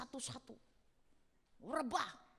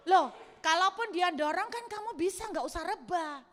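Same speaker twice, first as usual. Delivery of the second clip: louder, teasing protest; mock retort; 5 semitones higher, 6 dB louder than usual. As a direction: push back playfully, a bit louder. Retorting to husband. (membantah suami sambil bercanda, lebih keras)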